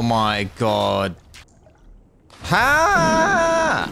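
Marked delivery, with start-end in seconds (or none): 2.4-3.9 frustrated shout. Real, not acted. Frustrated yell at game.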